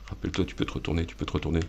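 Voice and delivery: ordinary delivery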